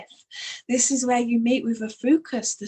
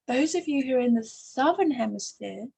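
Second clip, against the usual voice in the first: ordinary delivery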